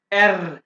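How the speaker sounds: एर with the tongue vibrating, a rolled German r. Shown as a demonstration. Teacher demonstrating the German r to students, rolled. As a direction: roll the r in एर, tongue trilling. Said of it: "यहाँ पे जुबान को vibrate करें".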